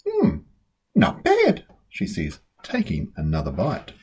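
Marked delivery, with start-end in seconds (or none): 0.1-1.7 put-on higher, grudging little girl approval; Lola tasting. A narrator's reading. Sister tasting a carrot, grudgingly pleased and higher. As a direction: approve while chewing.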